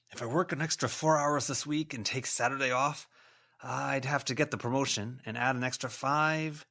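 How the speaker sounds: a hint of an anxious man muttering sums; muttering ghost. A narrator's reading. Man fretting to himself about a ring, anxious muttering.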